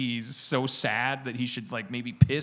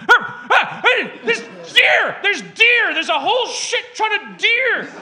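high, loud yelping dog voice, much higher; frantic high pup shouting about deer; excited dog voice; 11 dB louder than usual. Retold or imitated. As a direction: voice an overexcited dog spotting deer on TV.

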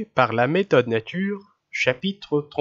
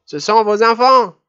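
put-on indignant old lady; 9 semitones higher, 9 dB louder than usual. A narrator's reading. Old lady accuses the mother.

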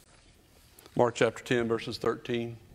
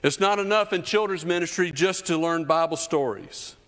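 ordinary talk, nothing marked

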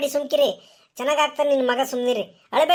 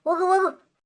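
crying as she says go; tearful urging. Acted. Mother sending someone for the doctor, crying. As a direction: choke it out through tears.

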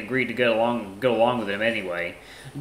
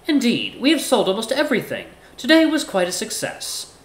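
higher-pitched, measured formal character; courteous Ty; 11 semitones higher, 3 dB louder than usual. Acted. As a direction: speak up high, politely composed. Voices Ty in story.

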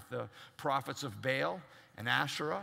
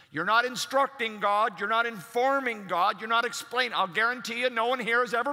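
preaching at full force; 6 semitones higher, 8 dB louder than usual. Acted.

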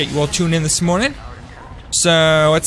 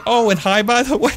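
higher, laughing mock of a tacked-on hello; mock casual afterthought; 8 semitones higher than usual. Streamer mimics viewer's late greeting, laughing mock. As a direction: parody the viewer's offhand hi, breaking into a laugh. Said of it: "and then just say".

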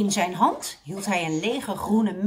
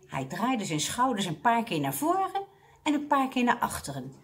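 plain reading voice

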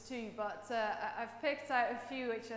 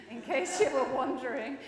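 ordinary talk, nothing marked

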